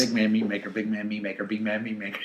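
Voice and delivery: rapid-fire repetition breaking into a laugh at the end; fast tongue twister. Shown as a demonstration. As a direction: race through the repeated phrase without a break. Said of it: "Say that five times fast".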